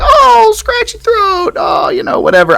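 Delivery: mock dismissive. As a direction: toss it off like it's nothing, way up high. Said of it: "I was like".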